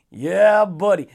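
ordinary talk, nothing marked

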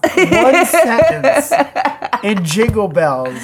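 loud, high, helpless laughter through words; real laughter breaking up the sentence; laughing protest. Real, not acted. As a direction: protest while cracking up. Cracking up at bad pun.

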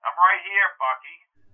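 muffled two-way radio reply; filtered radio voice; voice over the radio. Acted. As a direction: answer through a radio speaker. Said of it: "said Strickland over the radio".

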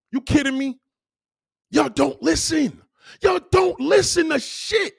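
high, slow, drawn-out complaint; exasperated scolding. Real, not acted. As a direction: stretch each word in high-pitched exasperation.

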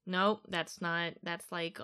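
normal talking voice

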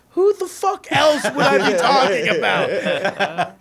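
slow, loud laughing yell of a roasting comic; mock shouted roast. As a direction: bellow it out mid-laugh, teasing. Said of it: "and he goes".

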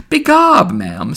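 high, loud exclamation dropping away; Missus Cahill. A narrator's reading. Neighbour replying to old woman, wheedling exclamation. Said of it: "went on hewing and wheedling".

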